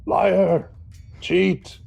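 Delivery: high-pitched accusing shout; shouted accusation. Acted. Player's character shouts at the dwarf from behind, accusing shout. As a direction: shout it out at him like an insult. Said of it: "I will shout out at him".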